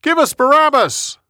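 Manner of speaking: high, loud shouted demand for Barabbas; loud mob cry, pitched high; crowd yelling. A narrator's reading. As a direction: yell it like a crowd making a demand. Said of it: "They yelled".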